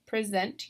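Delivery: first "present" as the verb, pree-ZENT; "present" with weight on its second syllable; last-syllable stress. Shown as a demonstration. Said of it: "I'm stressing the last syllable".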